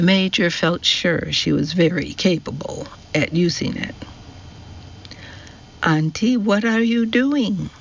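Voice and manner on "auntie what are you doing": pitched high, calling over the wind. A narrator's reading. Jasmina calls out to her aunt over the wind, pitched high. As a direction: call out to her aunt across the rain. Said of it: "called Jasmina".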